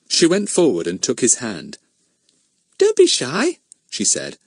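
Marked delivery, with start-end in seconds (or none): 2.8-3.6 put-on girl's gentle coax. A narrator's reading. Betts coaxing the shy boy.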